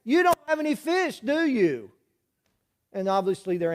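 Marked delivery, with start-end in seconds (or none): 0.0-1.9 "don't" pitched much higher, called from far off; hollering. Retold or imitated. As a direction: call it across a hundred yards. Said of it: "Jesus hollers from a hundred yards away".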